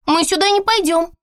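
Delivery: put-on high firm little girl; refusing flatly; bossy child. A narrator's reading. (властный детский отказ)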